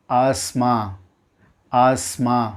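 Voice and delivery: ஆஸ்மா as AAS-maa; correct asthma. Shown as a demonstration. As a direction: silent TH in ஆஸ்மா. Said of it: "எப்படி உச்சரிக்கணும்".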